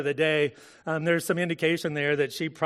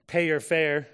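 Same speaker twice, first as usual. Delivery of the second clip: normal talking voice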